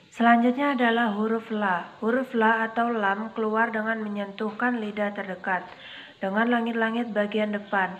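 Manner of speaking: ordinary delivery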